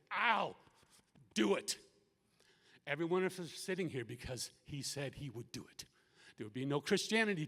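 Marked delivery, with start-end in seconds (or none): 0.1-1.8 raised, loud, broken word by word; resolute declaration. Retold or imitated. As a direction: proclaim it firmly, pausing midway. Repeats Jesus' resolve.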